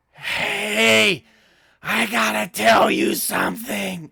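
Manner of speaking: loud raspy whisper, high; a drunk's loud stage whisper at the bar. Retold or imitated. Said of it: "doing the raspy whisper voice, but not being quiet at all".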